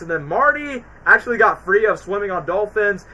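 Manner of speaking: normal talking voice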